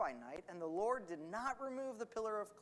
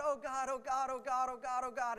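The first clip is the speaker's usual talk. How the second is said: raised, run-on mock plea; mock repetitive crying out; 5 semitones higher than usual. Preacher mimicking people stuck crying to God, mock pleading. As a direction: imitate someone stuck pleading on repeat.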